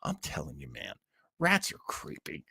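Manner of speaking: ordinary talk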